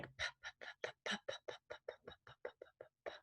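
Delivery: repeated voiceless "p" puffs, very soft; popcorn popping sound. Shown as a demonstration. Therapist showing the letter P sound, "p" as soft popcorn puffs. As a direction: pop out a run of airy "p"s like popcorn. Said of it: "the picture is of popcorn popping".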